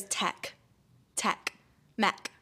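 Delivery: "tech" for take, "mech" for make, short e; imitating boyfriend's vowels. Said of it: "he does the funniest thing".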